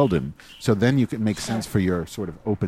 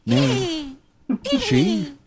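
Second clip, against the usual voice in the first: high mock intoning; much slower than usual. Ritual demanding the name.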